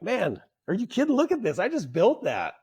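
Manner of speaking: can't believe what you're hearing; indignant disbelief. Retold or imitated. Apprentice protesting to his boss, high and indignant.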